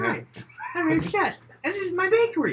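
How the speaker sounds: very high, loud hokey introduction; over-the-top high pitchman; bakery owner. Acted. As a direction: present the shop like a squeaky ad man. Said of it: "I totally just see him".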